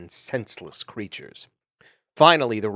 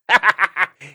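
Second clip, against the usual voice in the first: coarse cackle; 7 semitones higher, 9 dB louder than usual. Acted. Vermin laughing at cruel act.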